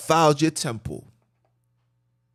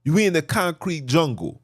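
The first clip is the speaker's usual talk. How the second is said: usual speaking voice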